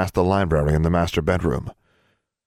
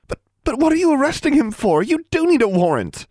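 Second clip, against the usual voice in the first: put-on very high, louder girlish objection; stammer, then protest urgently; distressed protest; 4 dB louder than usual. A narrator's reading. Daughter protests father's arrest.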